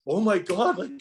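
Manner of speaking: choked up, pitch climbing high; the news just brought him to tears; tearful. Retold or imitated. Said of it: "I seriously burst out in tears".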